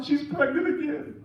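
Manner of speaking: high-pitched tearful mother imitation; mock crying. Mother telling the preacher about her daughter, mock crying. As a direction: lament it in pretend tears. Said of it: "She's crying".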